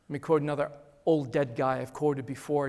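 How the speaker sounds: ordinary delivery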